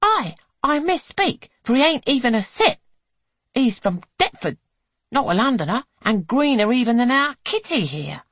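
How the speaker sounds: high, loud cheeky mockery; mocking. A narrator's reading. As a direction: scoff at a country bumpkin. Eleanor mocks merchant.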